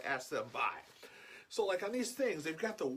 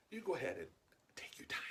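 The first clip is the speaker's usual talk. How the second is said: hushed, half-whispered aside; conspiratorial whisper; 6 dB quieter, faster than usual. Retold or imitated. Shopper to the lady ahead about the rude man, conspiratorial whisper. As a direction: lean in and murmur it slyly.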